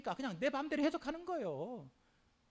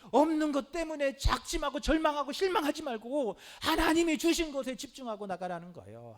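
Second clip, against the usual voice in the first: ordinary talk, nothing marked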